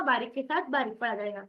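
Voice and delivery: ordinary delivery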